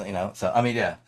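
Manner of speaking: usual speaking voice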